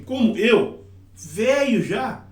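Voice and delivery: high "Como eu", then slow, drawn-out "velho já"; questioning intonation. Shown as a demonstration. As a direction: stretch "velho já" in high, swooping waves. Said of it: "Parece que é esse jeitinho".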